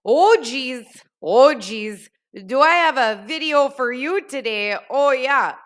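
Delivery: thick Minnesota accent, loud and hammed-up; playful exaggerated Minnesota accent greeting viewers; deep Minnesota accent. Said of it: "Really get that deep Minnesota accent going".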